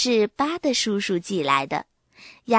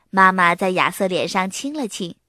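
plain reading voice

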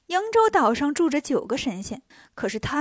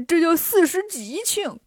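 smug clowning; 4 semitones higher than usual. Acted. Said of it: "八戒笑着说".

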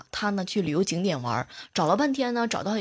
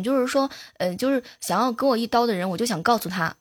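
ordinary talk, nothing marked

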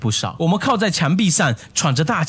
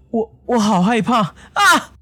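stammered fearful cry, rising high at the end; halting, higher terrified voice breaking into a yell; trembling with fear; 7 semitones higher, slower than usual. A narrator's reading. Scared student to his friend outside the dissection room, trembling. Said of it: "永峰哆哆嗦嗦的".

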